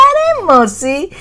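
put-on breezy young man, quick delivery; smooth talker reassuring the aunt; Jay voice. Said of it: "could you do the Sholay Mausi one for me".

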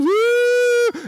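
very high-pitched imitation of a sobbing mother; mock crying wail. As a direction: wail like a mom bursting into tears, hammed up. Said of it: "my mom instantly starts crying".

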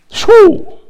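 high, sudden "shoo" whoosh; short high-pitched noise; splitting sound. Shown as a demonstration. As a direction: imitate something slicing through the air.

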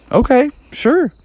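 high-pitched little frog voice; agreeable Froggy. Acted. Froggy agrees to python's wish.

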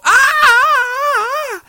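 a very high, loud panicked scream; cartoonish high scream of terror; mock shriek. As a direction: shriek like a fleeing crowd. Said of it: "all of them just go".

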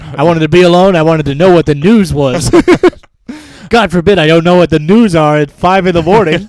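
a goofy dad who must know the morning news; mock dad. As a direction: ham up the news-obsessed dad. Joins dad impression.

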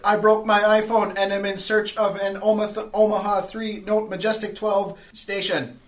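loud, high robotic pronouncement. Acted. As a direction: deliver it like a stiff machine boss. Robo explains to Sparrow.

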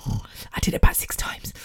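whispered confession, voice dropped right down; guilty whisper. Real, not acted. Owning up to skipping meditation, whispered. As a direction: let the admission slip out under your breath.

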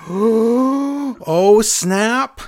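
big gasp, then high, loud "oh, snap"; crowd's shocked reaction. Retold or imitated. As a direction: imitate a scandalized audience. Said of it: "the audience is like".

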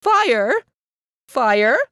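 loud high shout. A narrator's reading. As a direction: shout into the fireplace. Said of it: "She opened it and shouted".

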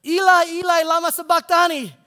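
a dying man calling to heaven at full voice; loud anguished cry. Retold or imitated. Jesus on the cross crying out to God, loud and anguished. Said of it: "called out with a loud voice".

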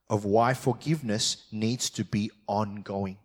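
ordinary talk

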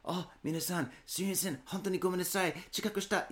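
bow through it like a humble train conductor; train conductor apology. Retold or imitated. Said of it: "speaker, and they're like".

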